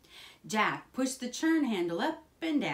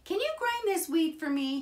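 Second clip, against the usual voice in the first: high, louder questioning voice; eager boy asking; 11 semitones higher, 5 dB louder, faster than usual. A narrator's reading. Jack asks the miller to grind his wheat, eager. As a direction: ask brightly, pitched well up.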